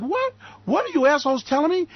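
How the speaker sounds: high squeaked disbelief into protest; incredulous outburst. Retold or imitated. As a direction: react in high-pitched, indignant disbelief. Retelling his incredulous reaction.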